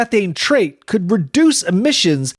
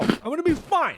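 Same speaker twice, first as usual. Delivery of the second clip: nervous; 9 semitones higher, 8 dB quieter than usual. Acted. Nervous self-reassurance, rising pitch.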